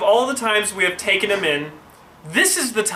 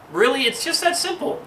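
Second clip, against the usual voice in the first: high, incredulous really; a startled aside, half laughing; surprised disbelief; 10 semitones higher than usual. Real, not acted. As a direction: sound amazed it could be so easy. Disbelief at police power.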